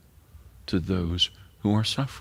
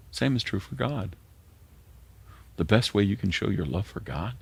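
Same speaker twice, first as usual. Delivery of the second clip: ordinary delivery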